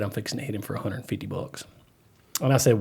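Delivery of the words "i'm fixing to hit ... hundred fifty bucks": much softer, hushed quote of the tow guy; retold whisper. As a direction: drop to a hush as the driver. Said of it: "whisper to that cop".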